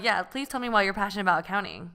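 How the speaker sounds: normal talking voice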